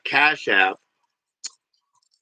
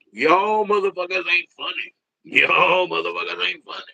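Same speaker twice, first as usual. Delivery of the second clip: mock comedian bit; 7 semitones higher than usual. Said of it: "mine probably would be like".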